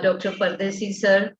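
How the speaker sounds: ordinary talk, nothing marked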